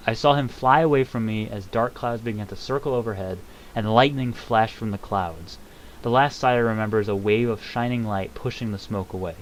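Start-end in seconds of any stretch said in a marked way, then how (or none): none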